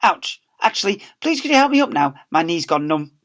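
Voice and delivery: Mister Lovey pained; uncomfortable plea. Acted. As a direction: wince and ask for help. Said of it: "beginning to look uncomfortable".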